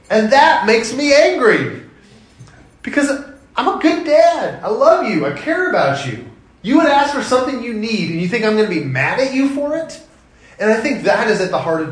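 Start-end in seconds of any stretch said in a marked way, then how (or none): 0.1-10.0 much higher, louder, hurt exasperation; exasperated. Real, not acted. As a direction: plead your case as a wounded dad.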